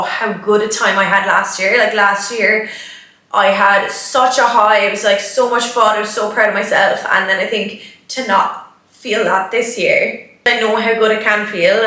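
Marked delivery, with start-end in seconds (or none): none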